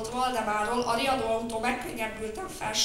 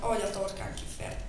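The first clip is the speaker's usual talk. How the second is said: ordinary delivery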